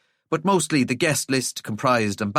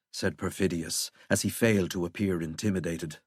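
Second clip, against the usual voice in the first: ordinary delivery, nothing marked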